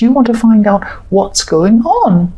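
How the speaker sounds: ordinary talk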